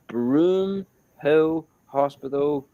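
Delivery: slow, spaced-out naming; careful dictation. Real, not acted. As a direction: say each word separately for someone searching. Giving name to search on Google.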